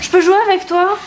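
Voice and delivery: put-on high, childish plea to join the game; little girl voice. Little sister begging to play the video game, childish high voice. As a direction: ask sweetly like a small girl.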